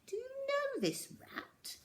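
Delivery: very high, soft, suspicious question; suspicious teacher. A narrator's reading. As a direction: probe her slowly with suspicion. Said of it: "said Miss Midge suspiciously".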